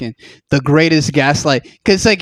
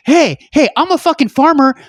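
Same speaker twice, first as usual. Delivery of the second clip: upset farmer; 6 semitones higher than usual. Retold or imitated. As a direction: rant as a desperate farmer. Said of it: "They're like".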